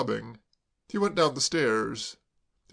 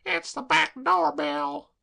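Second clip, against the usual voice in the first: much higher, louder, slow alarmed woman; Dolly; 13 semitones higher, 5 dB louder, slower than usual. Acted. Dolly hearing the bell, alarmed and high. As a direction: gasp it out high.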